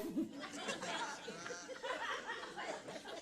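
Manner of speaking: high breathless wheeze of laughter; laughing. Real, not acted. Comedian cracking up at his own story, high wheezing laugh. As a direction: crack up silently.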